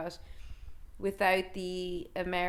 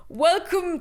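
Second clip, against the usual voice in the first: high, loud Walken-style greeting; a hammed-up Walken voice opening a film; Christopher Walken impression; 9 semitones higher, 6 dB louder than usual. Podcaster mimics the film's narrator, hammed-up Walken. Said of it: "this is Christopher Walken".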